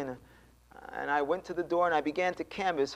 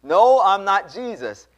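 loud, slow, raised-pitch retold denial; firm denial; 6 semitones higher, 10 dB louder, slower than usual. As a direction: insist on it, word by word. His firmer second denial.

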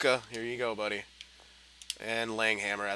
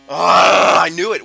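real groan turned shout, much louder and higher; frustrated yell; 7 semitones higher, 17 dB louder, slower than usual. Real, not acted. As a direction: cry out in defeat. Opponent scored again.

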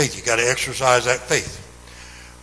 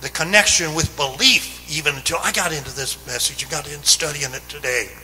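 louder, higher, urgent pulpit preaching; fervent Sunday preaching, ringing out; preaching at full force; 5 semitones higher, 6 dB louder than usual. Acted. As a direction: drive the message home to the congregation.

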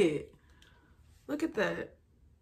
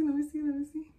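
ordinary delivery

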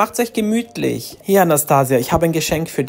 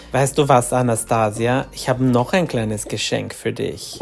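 sly low mother; 6 semitones lower, 3 dB quieter than usual. Acted. Mother hands over cruel gift.

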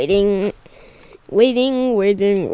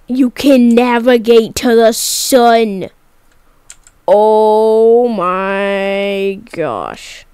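amazed; 4 dB louder, much slower than usual. Real, not acted. Discovers sun navigation option.

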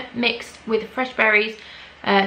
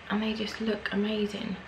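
whispering; 9 dB quieter than usual. Real, not acted. Whispering in awe.

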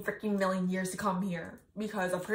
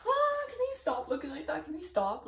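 overwhelmed fangirl whine; 6 semitones higher than usual. Real, not acted. Overwhelmed by handsome photo.

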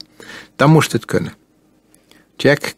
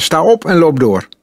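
higher, brisker command at normal volume; firm order; 7 semitones higher than usual. A narrator's reading. Boy refusing to talk to his captive, firmly ordering.

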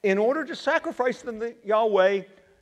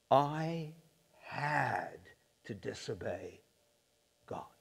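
much softer, halting words that fade away; sheepish mumble; 8 semitones lower, 13 dB quieter, slower than usual. Acted. Saul admitting fault to Samuel in a sheepish, fading mumble. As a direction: confess it slowly under your breath. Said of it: "He said".

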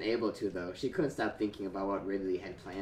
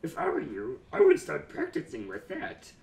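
villain voice; 5 semitones higher than usual. Acted. Ridley's note voiced by reader.

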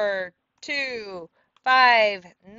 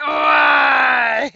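long, loud mock wail; mimicked yelled "Why?"; 3 semitones higher, 11 dB louder than usual. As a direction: imitate her cry, drawn out and over the top. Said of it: "She went".

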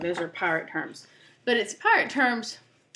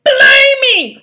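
huge, high pirate yell; pirate cry; 18 dB louder than usual. Acted. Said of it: "cried Pete".

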